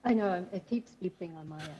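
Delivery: faint, distant mic voice; barely audible, far from the mic. Real, not acted. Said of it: "[name], your voice is very faint".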